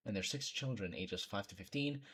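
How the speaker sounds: ordinary talk, nothing marked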